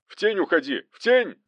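high shout climbing on the repeat; urgent, rising battle cry. A narrator's reading. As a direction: yell a warning mid-fight. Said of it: "снова закричал Захаров".

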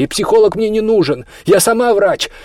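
high, forceful rebuff; curt refusal. A narrator's reading. Olya rejecting a psychologist, curt and indignant. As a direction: push back hard, loud and high. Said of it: "отрезала Оля".

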